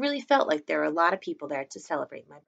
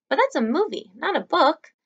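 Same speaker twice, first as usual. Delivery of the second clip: put-on kid objecting; 11 semitones higher, 5 dB louder than usual. A narrator's reading. Augie correcting Summer.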